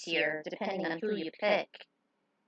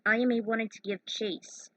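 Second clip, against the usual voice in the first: ordinary delivery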